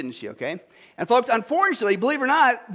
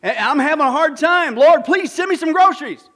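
pleading prayer; 5 semitones higher, 7 dB louder than usual. Retold or imitated. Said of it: "And she goes".

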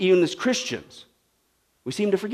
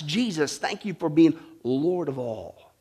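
mock prayer; 6 semitones lower, 5 dB quieter than usual. A hint of a believer's daily prayer to Jesus. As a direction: pray it gently and humbly. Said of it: "do we confess".

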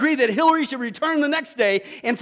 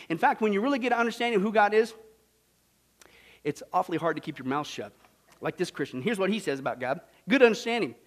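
usual speaking voice

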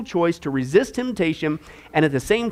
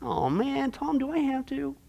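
high, soft whining complaint; a touch of petulant whine; whiny reluctance; 5 semitones higher, 9 dB quieter, slower than usual. Pretend. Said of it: "delight to do God's will".

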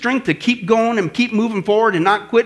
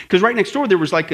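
normal talking voice

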